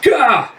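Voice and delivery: sharp high gah as he swings; high effort grunt. Acted. The giant lashing out with his mace, a high grunt. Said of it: "And Jeff".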